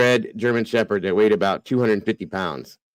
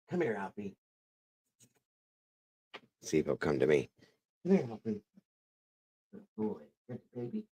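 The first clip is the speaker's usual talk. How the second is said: talking to a dog; 14 dB quieter, faster than usual. Real, not acted. Coaxing the dog to come.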